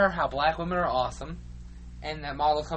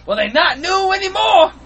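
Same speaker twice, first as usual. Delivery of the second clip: slow, high, very loud taunt; mock angry shout; 14 semitones higher, 12 dB louder than usual. Man who stomped on Robert's shoes, mock angry shout.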